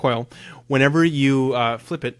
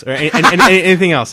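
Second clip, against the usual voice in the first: louder, higher exasperated cry; 6 semitones higher, 14 dB louder, much faster than usual. Real, not acted. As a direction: throw up your hands at him. Exasperated teasing.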